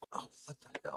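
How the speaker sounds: ordinary talk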